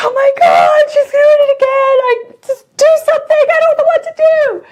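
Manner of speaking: high, loud, frantic pleading; panicked mum begging the doctor to act; shrieking in panic, fast at the end. Retold or imitated.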